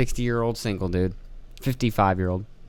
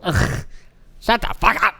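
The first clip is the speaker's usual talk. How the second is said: rising groan into a higher snap; fed-up groan then telling him off; imitating annoyed boss complaint; mock annoyed; 8 semitones higher, slower than usual. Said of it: "It's like".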